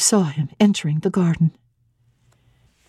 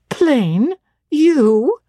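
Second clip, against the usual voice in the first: both words jump up high in disbelief; amazed, teasing disbelief; incredulous surprise; 8 semitones higher than usual. Acted. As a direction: scoff at the very idea. Diana scoffs at Jane calling herself plain.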